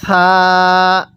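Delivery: gentle, drawn-out সা; soft letter সা. Shown as a demonstration. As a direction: say সা with a soft th-like sound, vowel held long. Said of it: "হ্যাঁ নরম করে". (সা নরম করে টেনে)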